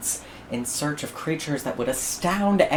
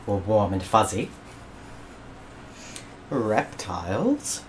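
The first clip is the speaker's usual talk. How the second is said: storybook listing; 3 semitones lower, 3 dB quieter than usual. A narrator's reading. Listing the perfect system.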